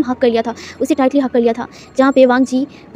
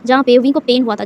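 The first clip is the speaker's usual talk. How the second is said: plain reading voice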